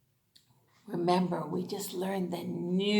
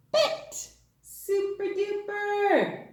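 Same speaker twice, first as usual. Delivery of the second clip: excited praise; 12 semitones higher, slower than usual. Acted. Praise after reading.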